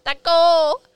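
calls the name out loud and high; excited shout. Real, not acted. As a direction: belt the dog's name with delight. Excited echo of dog's name.

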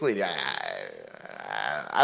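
low, slow, stuck on the words; stall, caught out; flustered stammer. Acted. Homeowner caught hiding the chicken, slow low stammer.

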